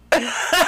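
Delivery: a high, bright laugh breaking out; real laughter, high; high burst of laughter. Real, not acted. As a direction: laugh loudly at the memory. Laughing at story.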